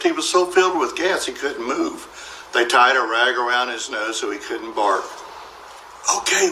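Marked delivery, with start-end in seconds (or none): 6.2-6.5 high, breathy burglar whisper. Acted. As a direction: warn in a sneaky whisper. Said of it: "whispered the first burglar".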